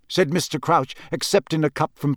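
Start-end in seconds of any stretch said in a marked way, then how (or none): none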